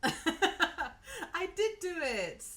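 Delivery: slow laughing admission. Real, not acted. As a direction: laugh through the words. Laughing at her mistake.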